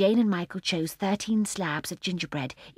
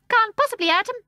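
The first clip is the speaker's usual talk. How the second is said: put-on high, loud little old woman; squeaky old shopkeeper; old lady voice; 9 dB louder, faster than usual. A narrator's reading. Old lady telling Mary Poppins, high old woman's voice.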